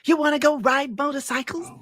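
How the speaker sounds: mimicking a neighbour, voice way up high; Teddy. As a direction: put on a high falsetto old-man voice. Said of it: "he used to talk like this".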